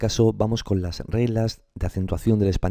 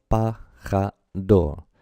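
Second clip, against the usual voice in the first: pa-ja-do very slow, one beat per syllable; three separate beats; much slower than usual. Shown as a demonstration. Teacher showing three golpes de voz, syllables slowly separated. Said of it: "son tres golpes de voz".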